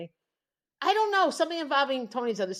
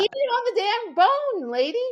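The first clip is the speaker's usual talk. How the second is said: mock exasperated retort; 10 semitones higher, 5 dB louder than usual.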